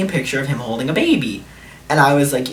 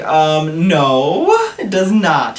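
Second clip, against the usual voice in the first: louder no, broken by a laugh; laughing refusal; 3 semitones higher, 6 dB louder, much slower than usual. Retold or imitated. Refusing a guy's lewd pictures, laughing. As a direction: laugh it off while turning him down.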